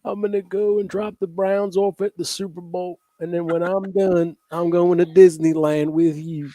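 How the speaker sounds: high, hammy Trump voice; Trump impression. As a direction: lay on the Trump voice.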